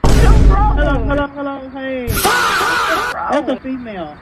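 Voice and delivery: ordinary talk, nothing marked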